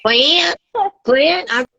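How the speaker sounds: high, drawn-out call of a son's name; mock mom calling Clint. Mother shouting her son's name. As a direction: call him like his mother would.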